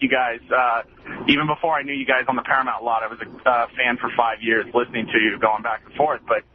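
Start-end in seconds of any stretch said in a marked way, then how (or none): none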